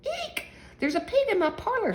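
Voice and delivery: high-pitched storybook shriek. A narrator's reading. Missus Primrose finds a pig in her parlor, shrieking. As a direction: squeal it high, in mock alarm. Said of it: "shrieked Missus Primrose".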